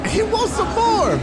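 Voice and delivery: high, loud mocking repeat; mocking shout. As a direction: yell the taunt back mockingly.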